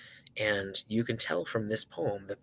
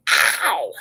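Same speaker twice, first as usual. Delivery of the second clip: crazy singer's scream; 8 semitones higher, 13 dB louder than usual. Shown as a demonstration. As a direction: burst out with a funky falling "ow". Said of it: "make kind of crazy scream sounds".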